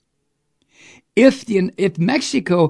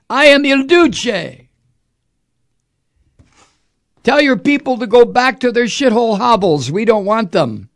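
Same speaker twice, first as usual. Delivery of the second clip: much higher and louder sneering tirade; mock Trump rant; 10 semitones higher, 7 dB louder than usual. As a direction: play him as a bullying tyrant, jeering. Host voices Trump to Central America.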